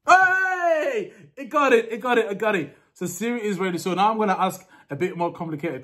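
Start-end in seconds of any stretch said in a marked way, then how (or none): 0.1-2.8 high-pitched triumphant cheer; real excitement, pitched up; excited cheer. Real, not acted. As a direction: celebrate with a drawn-out high hey. Celebrating Siri playing the music.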